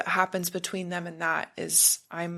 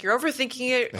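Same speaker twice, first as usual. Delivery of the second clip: dismissive mock voice, pitched up; brushing off her worries, a bit higher; imitating people; 6 semitones higher, much faster than usual. People brushing her off, mock dismissive voice. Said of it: "people would be like".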